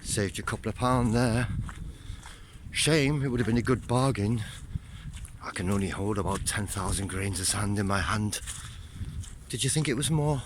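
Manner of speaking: a teasing, bad Scottish accent with a joker's glee. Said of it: "said [name] in a s-, bad Scottish accent".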